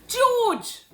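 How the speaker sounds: loud, high call for attention; father shouting for the boy. A narrator's reading. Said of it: "Mister Cranky shouted".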